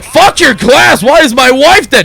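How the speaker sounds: hammed-up enraged dad; angry shout. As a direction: explode at your son. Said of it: "it's like".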